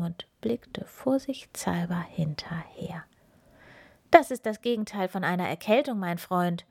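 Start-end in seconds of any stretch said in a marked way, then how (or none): none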